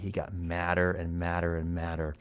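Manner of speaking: usual speaking voice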